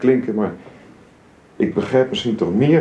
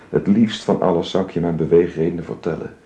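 ordinary talk, nothing marked

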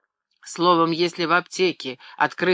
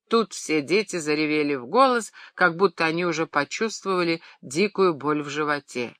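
plain reading voice

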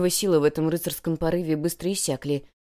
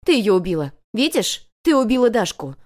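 loud, higher, hammered charge; furious accusation; 4 semitones higher, 7 dB louder than usual. A narrator's reading. Girl blames her rival for a death, furious. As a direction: accuse her with full force.

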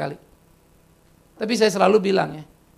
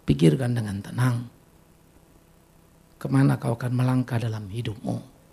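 guided reflection; 7 semitones lower than usual. Acted. Leads quiet reflection.

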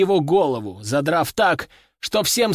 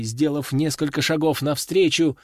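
ordinary delivery, nothing marked

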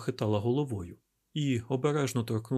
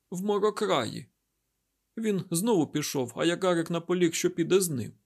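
higher-pitched, slightly louder character voice; injured woman recounting events; 6 semitones higher, 4 dB louder than usual. A narrator's reading. Derkhan tells Isaac about waking up, higher-pitched recounting. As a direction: voice a woman urgently telling what happened.

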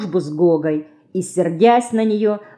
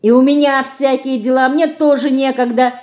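higher, hurried-sounding protest; moderately raised, pitched-up voice; calling out; 7 semitones higher, 4 dB louder than usual. A narrator's reading. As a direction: cry out over your sister. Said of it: "кричит Динка".

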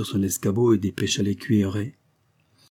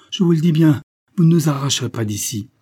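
loud, higher declaration; defiant protest; 6 semitones higher, 7 dB louder than usual. A narrator's reading. Mother refuses to leave her home, loud and defiant. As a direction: stand your ground with raised voice.

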